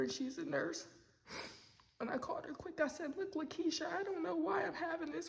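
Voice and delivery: high, choked-up retelling through tears; real tearful voice. Real, not acted. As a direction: tell it while fighting back real tears. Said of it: "Sorry for crying".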